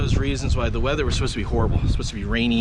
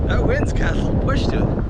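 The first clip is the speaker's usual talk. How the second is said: raised voice in wind; 11 semitones higher than usual. Real, not acted. Standing in strong wind.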